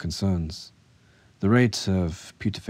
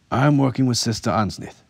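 firm and louder, steel in every word; a steely bluff, voice raised and firm; 6 semitones higher, 6 dB louder than usual. A narrator's reading. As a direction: bluff it out with a hard, commanding edge. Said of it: "putting steel to his voice".

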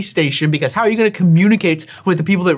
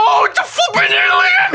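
high startled yell; shocked shout. Real, not acted. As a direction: yelp it out in shock. Alien suddenly appears.

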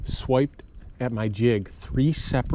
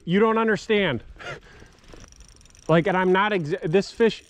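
urgent shouting; 5 semitones higher than usual. Real, not acted. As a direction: bellow across the water for your buddy. Yelling for friend with net.

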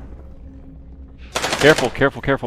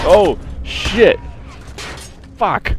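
startled shout; 9 semitones higher, 5 dB louder, much slower than usual. Real, not acted. Sudden danger in game.

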